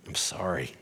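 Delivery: low, soft, sincere I'm sorry; sincere apology. Shown as a demonstration. Parent apologizing to the child, soft and sincere. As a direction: say it gently and mean it. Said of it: "most powerful words relationally".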